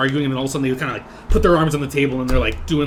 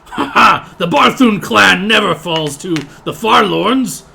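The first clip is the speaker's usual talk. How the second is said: loud, higher, slow gloating orc voice; boasting orc; 7 semitones higher, 10 dB louder, slower than usual. Acted. Muscular orc boasting to the tavern crowd, loud slow gloat. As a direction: crow the clan's victory slowly and loudly. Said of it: "he says".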